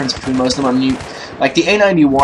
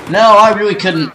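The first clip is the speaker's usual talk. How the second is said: louder and higher, exasperated; loud exclamation; 8 semitones higher, 4 dB louder than usual. Real, not acted. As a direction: blurt it out loudly in dismay. Admits failed grenade, exclaims.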